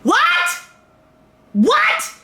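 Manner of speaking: squeaky high "What? What?" in disbelief; shocked disbelief. Retold or imitated. Recalling the jail news, stunned high disbelief.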